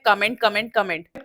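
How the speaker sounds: कमेंट with a weak first vowel, "kuh-ment"; wrong pronunciation of comment. Shown as a demonstration. Teacher quotes what everyone says, कमेंट as kuh-ment. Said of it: "ninety nine percent लोग गलत बोलते हैं".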